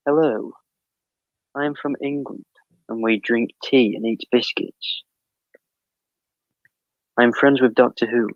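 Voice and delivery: careful, put-on English accent. Shown as a demonstration. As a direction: exaggerate a generic British accent. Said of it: "let me try it".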